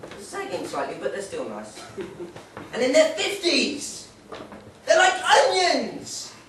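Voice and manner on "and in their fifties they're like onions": higher, louder and slow, laughing on onions; rising hammed-up reveal; building punchline.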